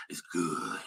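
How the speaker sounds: deep, slow seductive croon; low bedroom voice; sexy voice. Shown as a demonstration. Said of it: "I gotta use my real, real sexy voice".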